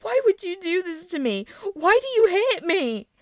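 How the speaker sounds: high, loud, slowing anguish; anguished plea. A narrator's reading. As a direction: ask why he hates you. Prince in pain asks father.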